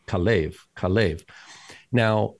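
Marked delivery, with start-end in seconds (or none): 0.1-1.2 Kalev with the accent on the second syllable; stress on second syllable. Shown as a demonstration. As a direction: lean on the last syllable of Kalev. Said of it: "consistently the second syllable that is accented".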